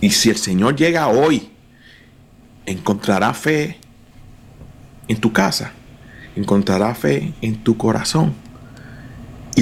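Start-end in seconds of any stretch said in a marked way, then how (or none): none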